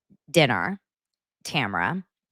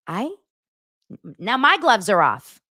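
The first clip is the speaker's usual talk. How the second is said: normal talking voice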